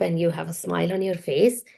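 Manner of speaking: usual speaking voice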